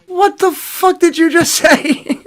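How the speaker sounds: slow, very high incredulous squeal; stunned disbelief. Retold or imitated. Driver stunned by friend's lie, high mock disbelief. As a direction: stretch it out high, breaking into laughter.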